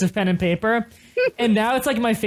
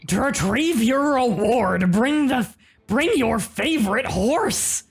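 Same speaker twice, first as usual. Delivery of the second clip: Gremlin voice; 3 semitones higher than usual. Acted. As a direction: read it like a grinning gremlin. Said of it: "You can read horse armor note in Gremlin voice".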